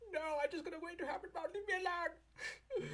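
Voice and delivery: very high mock crying whine; whiny client. Client whining at Saturn, mock crying. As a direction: wail it like a sulking child. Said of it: "And you're like".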